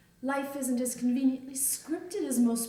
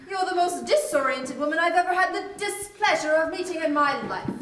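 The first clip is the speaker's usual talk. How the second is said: loud, raised-pitch outrage; indignant insult; 4 semitones higher, 6 dB louder than usual. Acted. Guest insults daughter in quarrel, indignant. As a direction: deliver the insult at full indignant volume.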